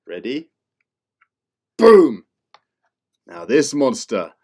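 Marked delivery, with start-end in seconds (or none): 1.8-2.2 sudden loud high boom to startle. Acted. Teacher startling kids with a loud monster boom. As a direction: jump out at the kids with it.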